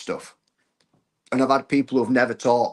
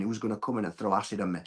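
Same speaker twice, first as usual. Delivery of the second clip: fearful inner voice; 2 semitones lower, 7 dB quieter, faster than usual. Retold or imitated.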